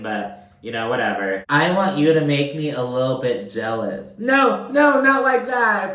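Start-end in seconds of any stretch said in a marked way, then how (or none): 1.5-5.9 high, loud mock protest; high, loud campy no; mocking sass. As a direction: camp it up as you correct her. Mocking Lisa then correcting herself.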